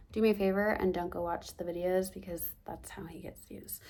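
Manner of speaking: conspiratorial whisper to viewers; whispered plea not to watch. Real, not acted. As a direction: hush it like a private plea. Aside asking viewers.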